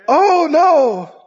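sudden high, loud "Oh no!"; acted alarm. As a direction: cry out like a pilot who just heard the crash. Acted pilot hearing gear noises.

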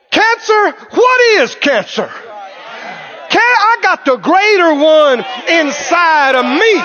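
high, scornful, impassioned challenge; defiant preaching. Acted. Believer facing down cancer, defiant. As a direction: scoff at it with total conviction. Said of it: "You say".

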